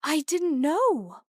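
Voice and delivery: high, slow, loud shout; anguished slow shout. A narrator's reading. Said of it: "he shouted".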